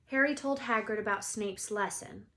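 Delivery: ordinary delivery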